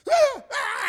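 very high, loud mock baby cry; imitated baby wail. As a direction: wail like a startled little one. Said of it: "he starts crying".

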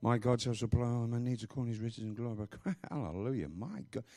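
low, soft mumbling to yourself; murmured under the breath; mumbled recitation. Shown as a demonstration. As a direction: mumble the verse along, words running together. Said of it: "just mumble along".

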